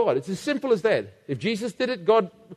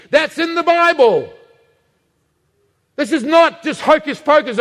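louder prophetic preaching, building to a high peak; fiery preaching; 4 semitones higher, 4 dB louder than usual. Acted. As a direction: thunder the prophecy at the congregation. Preaching at full force.

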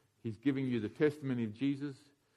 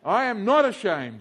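all together with gusto; 7 semitones higher, 9 dB louder, slower than usual. Shown as a demonstration. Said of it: "Say it again, all together".

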